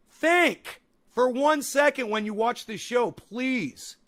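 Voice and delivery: loud, slow, pointed scolding; exasperated plea. Real, not acted. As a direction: spell it out to her in anger. Angry rant at chat commenter.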